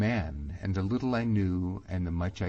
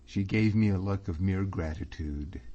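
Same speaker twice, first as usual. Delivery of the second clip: usual speaking voice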